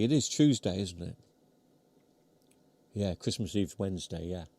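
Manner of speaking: chewing while talking; mouth full. Real, not acted. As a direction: talk through a mouthful of mince pie. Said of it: "you can probably hear me chewing".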